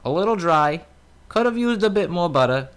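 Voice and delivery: put-on high, complaining old man. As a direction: fuss about the bread like a grandpa.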